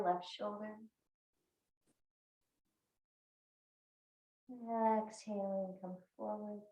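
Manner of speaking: guided breathing. Real, not acted. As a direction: lead the breath quietly, letting words fade out. Guides neck rolls with breath.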